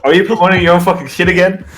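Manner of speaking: hammed-up fury; mock angry accusation. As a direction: rattle it off in fake outrage.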